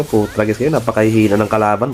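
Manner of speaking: ordinary talk, nothing marked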